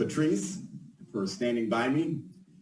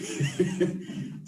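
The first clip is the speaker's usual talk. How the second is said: breathy laugh. Real, not acted. Laughs at own written speech.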